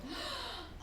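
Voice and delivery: sharp high gasp of shock. Acted. Widow gasps at the chloroform verdict. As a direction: gasp as the news lands.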